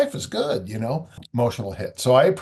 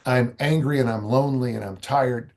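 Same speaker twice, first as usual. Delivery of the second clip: ordinary talk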